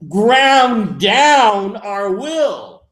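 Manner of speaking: high, loud, very slow mock decree; mock grim resolve. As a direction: drag it out as a self-crushing vow. Mocking the wrong approach.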